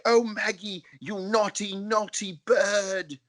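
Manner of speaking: put-on high, slow scolding in a British accent. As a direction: tut at the bird in a British accent, drawn out. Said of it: "he's British".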